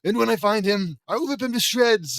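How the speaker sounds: loud, high, building to a furious vow; enraged. Acted. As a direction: build from indignation to a shouted threat.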